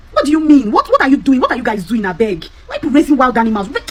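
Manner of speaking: loud, high, exasperated demand; exasperated telling-off. Retold or imitated. Telling off a careless mother.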